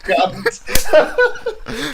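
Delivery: a gleeful, laughing echo of the insult; cackling while quoting the comeback; laughing repeat. Retold or imitated.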